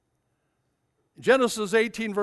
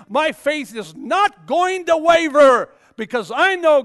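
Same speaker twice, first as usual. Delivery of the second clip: shouted, higher praise of God; full-force preaching; 6 semitones higher, 8 dB louder than usual. Acted. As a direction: preach it out loud and high.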